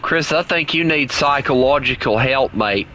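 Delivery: put-on oddly inaccurate Australian accent. Player accusing his teammate of shooting him, mock Australian accent. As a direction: scold in a bad mock Australian. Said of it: "using an Australian accent that oddly be inaccurate".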